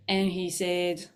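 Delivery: ordinary delivery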